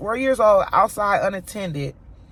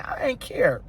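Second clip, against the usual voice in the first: ordinary talk, nothing marked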